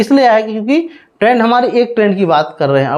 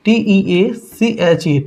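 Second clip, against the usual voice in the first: spelling it out; 4 semitones lower, much slower than usual. Shown as a demonstration. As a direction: spell each letter slowly for students to copy. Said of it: "ठीक है, sorry".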